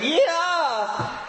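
sudden very high startled yelp; high squeal of fright; cartoon yelp. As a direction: shriek like a kid flipping over.

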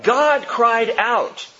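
God cried out drawn out very slowly, God hit hard; stress on God. Acted. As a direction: linger on God with awe. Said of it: "Now this is stunning to me".

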